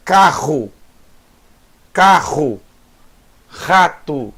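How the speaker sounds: "Carro, carro, rato" with throaty R, long pauses between; guttural Het sound. Shown as a demonstration. Teacher drills the Het sound, guttural R in "Carro, carro, rato". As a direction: rasp the R in each of "Carro, carro, rato". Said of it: "som de rato".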